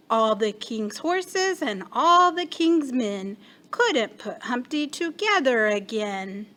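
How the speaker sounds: usual speaking voice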